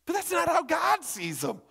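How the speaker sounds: high, loud, stretched key words; impassioned preaching. Real, not acted. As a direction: proclaim it with rising force. Preaches at full force.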